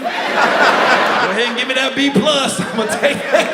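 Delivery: slow, loud, laughing brag; laughing boast. Retold or imitated. Student to teacher, laughing cocky brag. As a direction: gloat through laughter.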